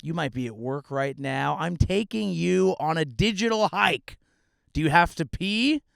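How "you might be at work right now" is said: usual speaking voice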